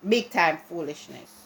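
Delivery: normal talking voice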